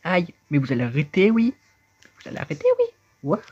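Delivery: high, loud panicked voice calling for help; shrill put-on squeal of a character in trouble; cry for help. Trapped character begging the monsters to stop, high and loud.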